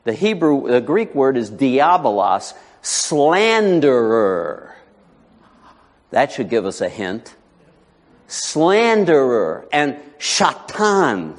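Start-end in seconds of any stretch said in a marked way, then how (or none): 2.8-4.8 "slanderer" stretched very slowly; very slow, weighty "slanderer"; drawn-out emphasis. Real, not acted. Spells out meaning of devil. 8.3-11.4 "Slanderer" and "shatan" stretched out slowly; slow, weighty naming; drawn-out emphasis. Real, not acted. Spells out names of devil.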